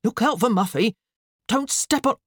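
high, urgent warning; alarmed. A narrator's reading. Father warning about Muffy, alarmed. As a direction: warn her urgently, cut off. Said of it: "looked up from the waffle griddle in alarm".

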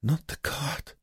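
high, soft, feeble protest; weak mumble. A narrator's reading. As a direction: mumble it like a sick, frightened prisoner. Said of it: "Joseph mumbled".

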